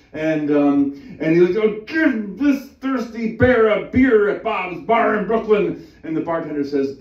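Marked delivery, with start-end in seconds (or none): 1.6-5.9 high, slow, loud bellow; hammed-up, drawn-out mock fury; angry bear demand. Enraged bear demands a beer.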